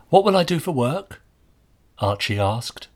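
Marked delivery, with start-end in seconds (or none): 0.1-1.2 put-on higher, louder plaintive boy's voice. A narrator's reading. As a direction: ask it as a plaintive plea.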